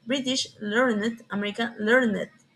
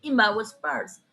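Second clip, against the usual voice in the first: ordinary delivery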